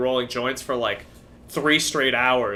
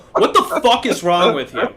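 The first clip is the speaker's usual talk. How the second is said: louder and higher, aghast; mock outrage; 9 semitones higher, 8 dB louder than usual. Outraged at the friend who cooks pasta in cold water. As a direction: throw up your hands in disbelief. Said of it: "And it's like".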